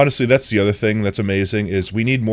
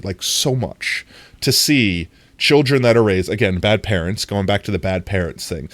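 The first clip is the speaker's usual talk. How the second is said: ordinary talk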